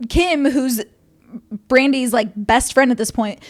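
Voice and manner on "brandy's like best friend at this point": ordinary delivery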